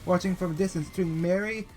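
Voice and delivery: scripture reading. Acted. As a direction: read the passage like a sermon. Reads Bible passage aloud.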